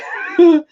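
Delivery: high, loud little laugh; burst of laughter. Real, not acted. Host joking her developer will hate her, high laugh. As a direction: laugh out at your own trouble.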